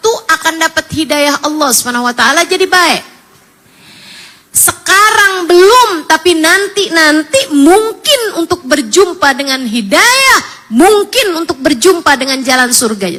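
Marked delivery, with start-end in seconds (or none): 4.5-13.2 high, loud impassioned preaching; fervent preaching. Acted. Preacher urging the women to pray sincerely, fervent preaching. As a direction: preach it with full fire.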